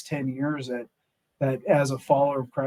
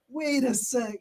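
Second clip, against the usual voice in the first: sudden realization; 9 semitones higher, slower than usual. Retold or imitated. Realization moment retold.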